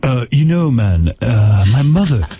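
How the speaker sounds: a light mock of a spaced-out storyteller; spacey alien-abductee impression. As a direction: drift through it like a tripped-out guest. Howard riffs on Riley's tale.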